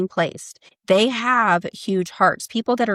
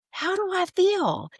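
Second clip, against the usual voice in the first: high, soft and slow inner question; gentle self check-in; 11 semitones higher, 5 dB quieter, slower than usual. Shown as a demonstration. Showing listeners how to check in, soft and slow. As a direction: ask yourself softly and slowly. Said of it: "just check in and go".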